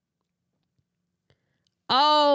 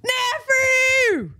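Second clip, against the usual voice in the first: high, thrilled call with a falling end; overjoyed high exclamation; excited cry; 12 semitones higher than usual. Real, not acted. Gushing over the baby photo.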